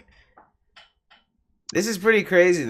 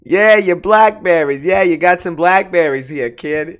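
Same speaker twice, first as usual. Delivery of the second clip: put-on louder, higher tough-guy Boston accent; mock Boston tough guy; 7 semitones higher, 9 dB louder than usual. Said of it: "Is that how they talk?".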